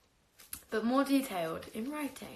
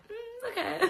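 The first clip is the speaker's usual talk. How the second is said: Victor's mock reaction. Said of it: "he was like".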